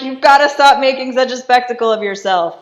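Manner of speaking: high, loud exasperated reprimand; loud mock scolding. Wife scolding her raging husband, loud and mock exasperated. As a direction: scold over the top of the other person.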